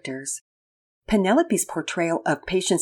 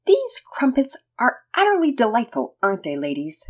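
genteel tea party hostess; 6 semitones higher than usual. Acted. Penelope playing posh mom at tea.

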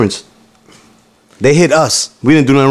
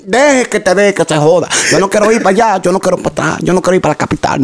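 imitating the mom; 6 semitones higher, faster than usual.

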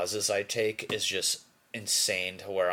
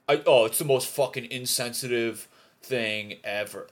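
loud, raised mock outrage; hammed-up indignant complaining; mock bitching; 4 semitones higher, 8 dB louder than usual. Imitating people who hate gift cards, mock bitching. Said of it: "bitching about a gift card".

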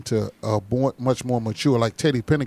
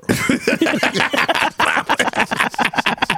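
high cackling laugh; 11 semitones higher than usual. Real, not acted. Laughing at his own story.

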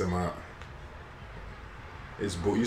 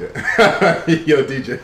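louder, words broken by laughter; laughing; 7 semitones higher, 10 dB louder, much slower than usual. Real, not acted. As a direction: crack up while saying it. Laughing at the moose joke.

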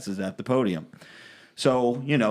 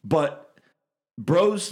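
usual speaking voice

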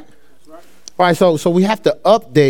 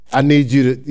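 ordinary talk, nothing marked

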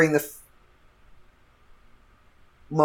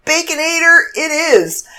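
mock cheer; 10 semitones higher, 6 dB louder than usual. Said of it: "I'm like".

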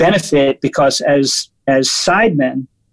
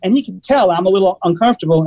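ordinary talk, nothing marked

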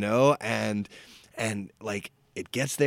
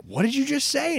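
high-pitched retold disbelief; shocked disbelief. Waitress to the old customer, shocked and incredulous. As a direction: imitate someone aghast at what they heard.

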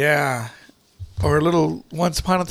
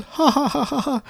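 imitated laughing routine; 7 semitones higher, 5 dB quieter than usual. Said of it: "Fred the Savage would do the whole".

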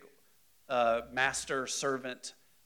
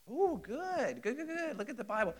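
excited praise; 11 semitones higher than usual. Real, not acted. Praising class answer.

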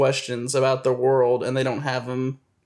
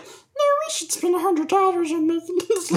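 very high inner-voice nag; very high critic voice nagging from the back of the mind. Retold or imitated. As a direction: play the little voice in your head. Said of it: "And my voice is just like".

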